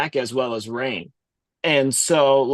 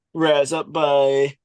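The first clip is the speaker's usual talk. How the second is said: normal talking voice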